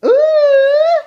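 very high, loud ooh; excited ooh. Real, not acted. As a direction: squeal with delight. Baby says thanks.

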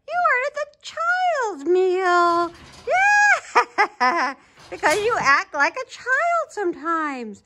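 high, drawn-out mocking coo with laughter; hammed-up high teasing, breaking into laughs; gleeful teasing. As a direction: tease a passenger like a little child. Teasing Mister Genesis about child's meal.

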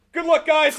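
loud, high cheery shout; retold yell of good wishes; imitated calling out. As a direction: call out to a team in passing. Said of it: "I just went".

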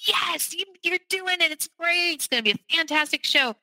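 imitating excited friends; cheering. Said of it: "cheering me on".

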